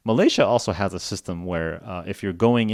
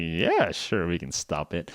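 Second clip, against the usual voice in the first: a touch lower and softer, sly grin; smiling bad guy; 4 semitones lower, 4 dB quieter, slower than usual. Scammer revealing his scheme, lightly sly and smug. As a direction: let the villain smile through it. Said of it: "the bad guy starts to smile".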